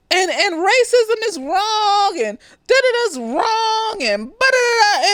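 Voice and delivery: slow, high, mock-earnest speechifying; mocking a preachy speech. Willie giving his school speech, mock earnest and slow. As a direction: drag it out like a hollow sermon.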